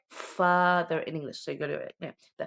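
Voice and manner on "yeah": ordinary delivery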